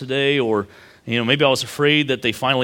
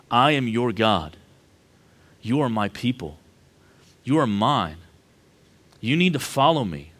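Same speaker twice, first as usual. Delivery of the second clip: voicing God; 2 semitones lower than usual. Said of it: "This is what God's saying here".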